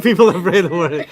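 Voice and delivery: laughing through the words. Real, not acted. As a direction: laugh while you say it. Laughs repeating the phrase.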